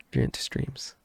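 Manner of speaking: breathy whispered aside; whispering. Acted. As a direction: drop to a whisper for the plug. Quiet aside to viewers.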